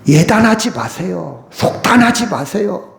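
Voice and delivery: ordinary delivery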